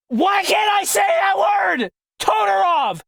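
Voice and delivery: high-pitched shout, dragged out and falling; frustrated shouting. Real, not acted. Narrator stuck on one word, shouting in frustration. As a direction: rage at your own tongue, voice way up. Rages at own repeated mispronunciation.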